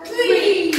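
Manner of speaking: "three" shouted, high and loud; loud countdown call. Real, not acted. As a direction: call out the last count to start the throw. Counting down for the throw.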